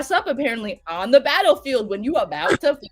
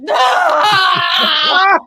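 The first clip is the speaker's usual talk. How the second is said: loud, higher drawn-out yell; battle yell; 5 semitones higher, 11 dB louder than usual. Retold or imitated. Retelling a drunk knight's sword swing, battle yell. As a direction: let out a wild swinging yell.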